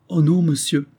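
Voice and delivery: high, plaintive protest; tearful. A narrator's reading. Esther answers the captain's reproach, crying. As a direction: protest as if on the verge of tears. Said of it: "recommença à pleurer à ces mots".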